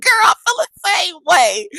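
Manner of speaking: high, loud words through laughter; laughing loud and high while agreeing. Retold or imitated. Laughing with the teacher.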